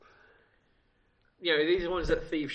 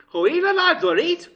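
high, loud mock Geordie; Geordie accent; 13 semitones higher, 10 dB louder than usual. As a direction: attempt a hammed-up Newcastle accent, high and loud. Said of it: "Do you want me to do mine".

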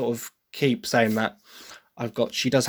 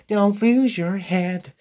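soft, slow and higher; soft version, higher and slow; soft American delivery; 7 semitones higher, much slower than usual. Shown as a demonstration. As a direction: say it gently, no bite. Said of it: "It's, it's very soft".

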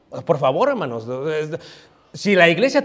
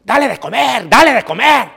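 retold yelling father; 5 semitones higher, 5 dB louder than usual. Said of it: "le está gritando el otro".

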